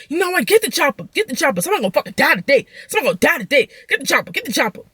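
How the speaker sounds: high, hammed-up girlfriend threatening; mock threat. Angry girlfriend outside the car calls for a weapon, high mock threat.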